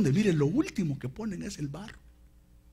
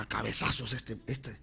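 ordinary talk

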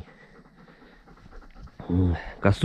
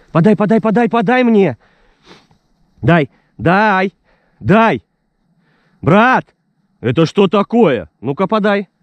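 insistent command; 10 semitones higher, 4 dB louder than usual. Real, not acted. Insisting on the retrieve.

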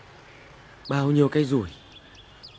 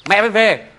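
urgent call; 8 semitones higher, 7 dB louder than usual. Acted. Father urgently calling to wife.

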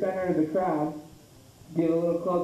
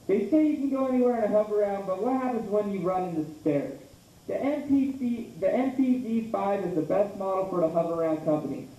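lively, higher-pitched infomercial read; TV commercial pitch; 4 semitones higher than usual. Acted. Comic performing a written Hoveround commercial monologue, peppy ad pitch. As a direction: sell it like a mock TV ad announcer.

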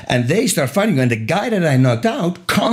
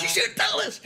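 wife's voice; 13 semitones higher, 11 dB quieter than usual. Retold or imitated. Imitating his wife scolding, laughing.

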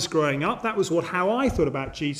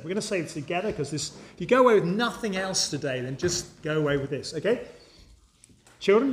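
normal talking voice

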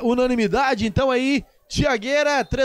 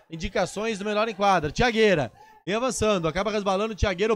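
ordinary talk, nothing marked

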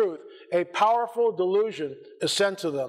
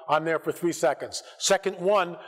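ordinary talk

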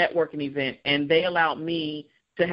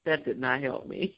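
deadpan; 4 semitones lower, 6 dB quieter than usual. Retold or imitated. Said softly to mom.

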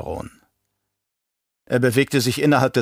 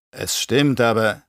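zögernd; slower than usual. Acted. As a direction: concede it reluctantly, left hanging. Nephew meekly concedes to Baron. (zögerndes Zugeben, offen gelassen)

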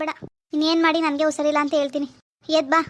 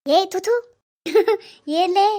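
disgusted laugh; 4 semitones higher, 4 dB louder, much slower than usual. Acted. Husband reacts to wife's fart.

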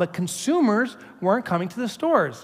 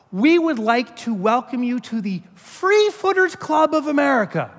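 grand letter read; 7 semitones higher than usual. Acted. Reading a welcome letter.

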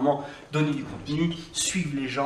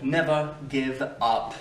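slow emphasis; much slower than usual. Shown as a demonstration. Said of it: "n'abandonne jamais".

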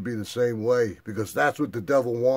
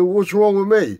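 1 indignant question; 9 semitones higher, 8 dB louder than usual. Retold or imitated. Arguing with brother, high.

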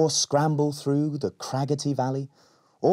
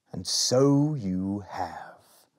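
slow wise lizard; much slower than usual. Acted.

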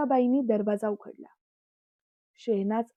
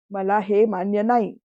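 louder, flat angry no; angry refusal; 6 dB louder than usual. A narrator's reading. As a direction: shut it down with a louder voice. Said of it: "रागात बोलले".

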